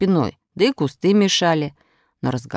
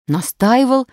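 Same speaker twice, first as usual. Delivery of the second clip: higher, louder prompt agreement; ready agreement; 9 semitones higher, 5 dB louder, slower than usual. A narrator's reading. Krasilnikov agrees about the cut scrap, ready and firm. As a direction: confirm it readily and firmly. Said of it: "с готовностью согласился Красильников".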